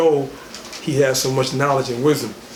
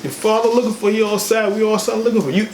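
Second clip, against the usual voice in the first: raised pitch, reproachful mother; higher mock mother complaint; 6 semitones higher than usual. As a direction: pile on the guilt, voice up high. Mother voiced scolding son.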